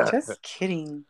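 slow and laughing. Real, not acted. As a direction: laugh the words out. Laughing after joke.

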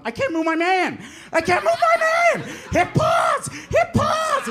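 hammed-up desperate kid pleading; mock panic. Mark panicking mid-game.

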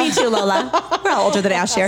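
chuckling through the words; laughing joke. Real, not acted. Host jokes about age with Lola, laughing. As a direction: tease with a laugh.

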